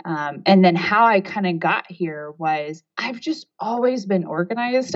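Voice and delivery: ordinary talk, nothing marked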